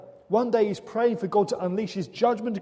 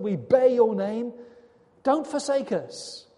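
raised, higher voice crying out to God, slowing at the end; louder, higher impassioned plea, slowing on the last lines; anguished pleading prayer; 5 semitones higher, 6 dB louder than usual. Acted. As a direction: plead like a desperate prophet.

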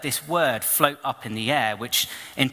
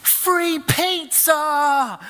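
high, loud, very slow "free pizza!"; drawn-out shout; 14 semitones higher, 4 dB louder, much slower than usual. Retold or imitated. Shouting to Dave across the park, long and high. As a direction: stretch it out to lure him back. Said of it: "Like this".

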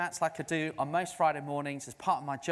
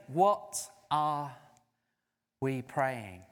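usual speaking voice

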